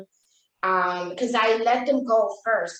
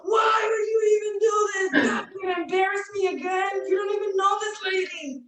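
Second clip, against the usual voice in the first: much higher, near-crying shout; screaming daughter; 11 semitones higher than usual. Retold or imitated. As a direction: yell it like a teen embarrassed to tears. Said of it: "she was screaming".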